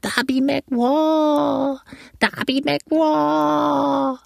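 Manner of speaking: very high, very slow, drawn-out wail; eerie wailing ghost voice; ghostly whine. A narrator's reading. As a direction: moan it like a lost spirit far away. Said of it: "Dieses Wort bezeichnet am besten den Klang".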